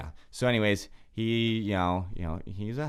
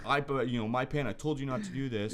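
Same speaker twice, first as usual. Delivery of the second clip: higher mock roommate demanding payment; mimicking huffy roommate; 6 semitones higher than usual. Roommate storming in, demanding sixty dollars from Dylan, higher mock voice.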